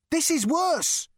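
loud, very high and slow; forceful insistence. A narrator's reading. Rooster answers Zaphod's comparison, forcefully.

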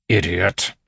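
scornful bandit. A narrator's reading. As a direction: scold a dim accomplice. Bandit scolds his fellow.

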